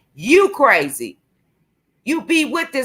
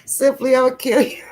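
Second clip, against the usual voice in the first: higher voice, cracking up; breaking into laughter; 3 semitones higher than usual. Real, not acted. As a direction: try to stay serious and fail. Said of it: "I wasn't supposed to laugh".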